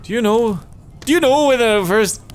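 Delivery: put-on high, loud American accent for the character. Character asking the player, in a high American accent. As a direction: pitch the American voice way up and push it. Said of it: "Let me get back in this American accent".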